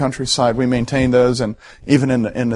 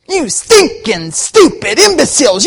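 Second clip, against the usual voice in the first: mock tantrum, much higher and louder, slow and drawn out; hammed-up rage at "imbeciles", pitched way up; mocking angry outburst; 12 semitones higher, 7 dB louder than usual. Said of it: "all of us mocked him".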